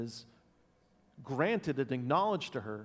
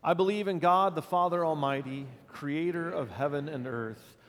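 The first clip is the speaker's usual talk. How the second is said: loud opening of a recited creed; creed recitation; 3 dB louder than usual. Acted. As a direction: lead the church in the creed.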